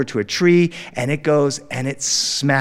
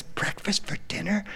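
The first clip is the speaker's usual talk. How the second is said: softer, breathless whispered wonder; awed whisper; 6 semitones higher, 10 dB quieter than usual. Retold or imitated. Little boy hearing about breakfast for dinner, awed whisper. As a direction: whisper it like the best news ever. Son amazed by the waitress's offer.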